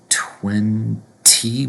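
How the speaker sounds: "twenty" split as "twen-TEE", crisp second T, rising; both T's sounded. Shown as a demonstration. Teacher showing the spelling of twenty, both T's sounded. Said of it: "we have two T's".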